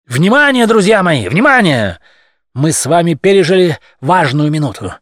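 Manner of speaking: loud oratorical address; formal speech. Acted. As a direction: address the crowd loudly. Leader addressing the crowd.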